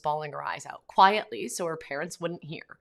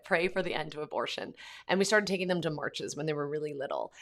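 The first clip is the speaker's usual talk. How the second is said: ordinary delivery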